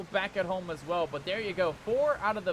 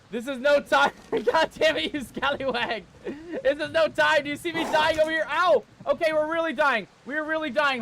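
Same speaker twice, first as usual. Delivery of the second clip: high, loud frantic yelling; frantic shouting; 6 semitones higher, 6 dB louder than usual. Real, not acted. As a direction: shout while taking hits. Under attack, dying in game.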